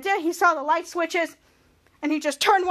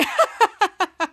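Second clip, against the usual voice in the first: staccato fake ha-ha laugh; imitated laugh; 4 semitones higher than usual. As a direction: act out a character's big laugh. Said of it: "Ray laughing".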